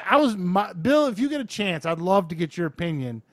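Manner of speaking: ordinary talk, nothing marked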